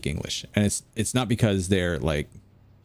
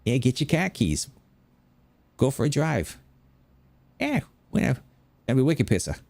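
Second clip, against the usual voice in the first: put-on broad Boston accent, pitched up; 5 semitones higher than usual. Bostonian heading out for a drive, high broad Boston accent. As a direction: play a Bostonian with dropped R's. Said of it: "in Boston, you say khakis".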